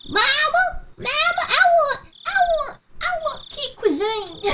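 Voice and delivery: put-on very high, whiny-kid Southern squeal; mock a little girl begging Mama. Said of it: "overweight little girl with the golden curls".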